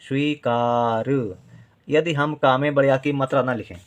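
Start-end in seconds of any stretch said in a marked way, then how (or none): 0.0-1.4 "स्वीकार" slowly, with का held long. Shown as a demonstration. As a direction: draw out the आ in "स्वीकार". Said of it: "का देर तक बोल रहे हैं". ("स्वीकार" में का को देर तक खींचकर)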